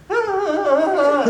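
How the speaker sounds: playful mock wail; mock boo-hooing. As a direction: imitate her crying fit, hammed up. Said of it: "like boo-hooing".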